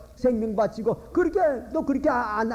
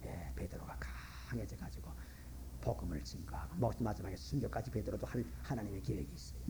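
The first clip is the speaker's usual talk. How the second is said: hushed preaching; 12 semitones lower, 17 dB quieter than usual. Real, not acted. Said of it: "sermon lines dropped low".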